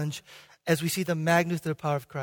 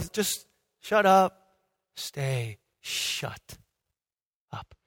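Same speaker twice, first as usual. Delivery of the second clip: slow command sinking to very quiet; no posturing; 5 semitones lower, 5 dB quieter, much slower than usual. Acted. Jesus stilling wind and sea, unpostured and quiet. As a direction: drop all show, draw the words out softly. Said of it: "No posturing".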